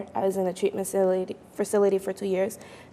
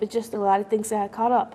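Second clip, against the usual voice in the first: ordinary talk, nothing marked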